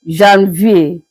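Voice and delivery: Janvier said zhan-vee-ay; French pronunciation. Shown as a demonstration. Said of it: "pronounced as Janvier".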